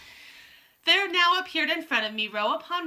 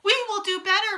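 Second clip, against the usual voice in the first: usual speaking voice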